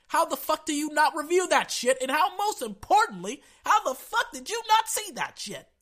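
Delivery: high, loud, disbelieving shout; furious yelling. Real, not acted. Fan raging at the league over the missed call, yelling. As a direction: yell it at the refs who blew it.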